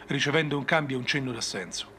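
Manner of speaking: plain reading voice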